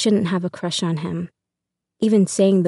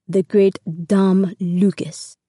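slow, heavy Russian accent mimicry; thick Russian accent; much slower than usual. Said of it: "he mimics Mister K's thick Russian accent".

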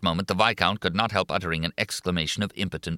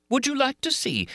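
much higher, mocking; shrieking taunt. A narrator's reading. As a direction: leap up mockingly. Monster taunting louder.

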